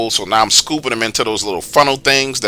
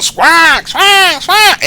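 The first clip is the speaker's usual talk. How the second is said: very high, screechy crow cries; imitated crow squawks; 3 dB louder, much slower than usual. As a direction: screech like crows scattering. Imitating the crows dispersing.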